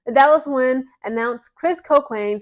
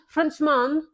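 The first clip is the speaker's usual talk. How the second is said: badly faked French accent on "Frenchman"; mock French pronunciation of "Frenchman"; terrible French accent; 4 semitones higher than usual. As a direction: attempt "Frenchman" with French vowels, poorly. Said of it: "that's a terrible accent".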